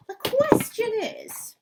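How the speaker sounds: high, loud and slow; teacher asking children dramatically, slow and high; slow dramatic question. Acted.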